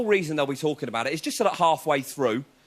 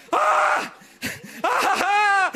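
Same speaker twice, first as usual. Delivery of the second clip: mock screaming. Camper mimicking the drugged bloke screaming. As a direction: shriek like someone off their head. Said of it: "screaming this time".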